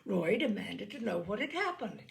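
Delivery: ordinary delivery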